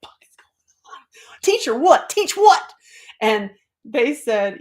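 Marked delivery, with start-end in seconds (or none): 0.0-1.4 barely audible whispered swearing, retold; voiceless, stunned aside; cursing under breath. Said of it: "like, the cursing". 1.4-2.7 high, loud mock disbelief; hammed-up baffled protest; incredulous outburst. Retelling incredulous reply to the beings.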